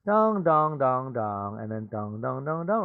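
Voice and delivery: sung bell tones, up and down; ding-dong like the school chime before an announcement; school chime sounds. Shown as a demonstration. Teacher imitating the school announcement chime for the class. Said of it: "they make the announcement ... it goes dong".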